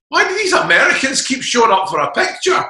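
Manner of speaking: put-on high, loud kid voice; puzzled little kids. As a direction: wonder aloud like a confused child. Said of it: "these little kids going".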